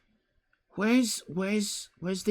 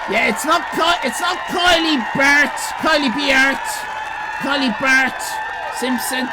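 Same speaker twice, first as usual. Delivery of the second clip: much higher and loud teasing yell; mock outraged shout; 10 semitones higher, 5 dB louder than usual. Real, not acted. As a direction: roast the chatter at full volume. Streamer yelling at chat user.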